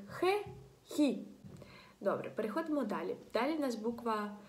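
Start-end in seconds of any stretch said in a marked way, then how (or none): 0.1-1.3 "хе" and "хі" with kh, separately; plain kh reading. Shown as a demonstration. Teacher showing the reading without u, kh sound. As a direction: sound out "хе", then "хі", with kh. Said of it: "буде читатися просто як".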